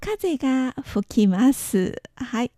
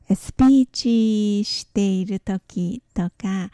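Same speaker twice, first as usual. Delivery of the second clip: ordinary talk, nothing marked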